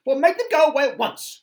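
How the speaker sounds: very high, loud, fast demand; cried out. Acted. Miss Bossy ordering Wilfrid, very high loud cry. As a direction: screech an order at the wizard. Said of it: "cried Miss Bossy".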